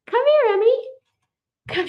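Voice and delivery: very high singsong invitation. Real, not acted. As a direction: beckon a little one brightly. Calling toddler to come over.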